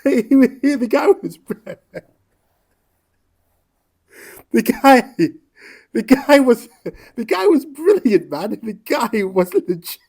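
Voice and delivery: real furious, laughing shout; outraged yelling. Real, not acted. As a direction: yell it in furious disbelief. Outrage at the death, yelling praise.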